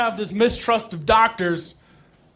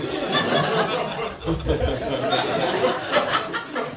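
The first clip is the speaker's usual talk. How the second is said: crowd laughter; 3 semitones lower, 5 dB quieter than usual. Real, not acted. Audience laughs at joke.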